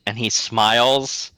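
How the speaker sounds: normal talking voice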